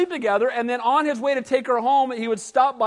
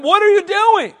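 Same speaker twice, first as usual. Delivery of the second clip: loud, high outraged cry; mock priest yelling at thieves; indignant shout; 8 semitones higher, 7 dB louder than usual. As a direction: bark it out in alarm. Priest protesting idol theft.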